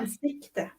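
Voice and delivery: "Ansikte" said softly as a Swedish word; quiet demonstration of Swedish "Ansikte". Shown as a demonstration. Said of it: "It's hard, I know".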